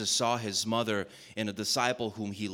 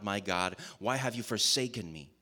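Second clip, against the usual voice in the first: normal talking voice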